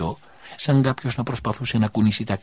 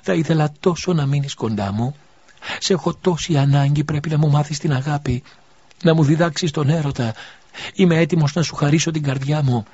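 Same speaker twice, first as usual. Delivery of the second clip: higher, louder, urgent pleading man; a man begging the dying woman he loves to stay, higher and louder; pleading lover; 7 semitones higher, 5 dB louder than usual. A narrator's reading. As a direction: beg her to stay with you. Elias pleads with the dying widow.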